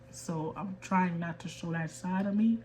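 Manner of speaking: usual speaking voice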